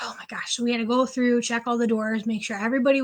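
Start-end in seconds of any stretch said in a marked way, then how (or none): 0.0-0.6 barely voiced, spooked under her breath; scared whisper. Aide spooked by ghostly door alarms at one o'clock, whispering. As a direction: breathe it out in a frightened hush.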